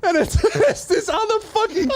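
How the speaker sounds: high, squeaky voice through laughter; cracking up while telling it; real laughing disbelief. Real, not acted. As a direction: pitch shooting up with laughter. Cracking up at his own punchline.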